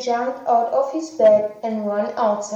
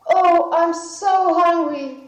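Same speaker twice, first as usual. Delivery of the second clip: slow, higher, louder moaning of hunger; hungry Jack; 6 semitones higher, 4 dB louder than usual. Acted. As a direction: groan about being hungry. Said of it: "said Jack".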